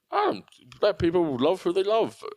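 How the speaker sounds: hammed-up rambling political rant; Bob Katter. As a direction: imitate a senator veering from genial to indignant. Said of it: "Senator Bob Katter".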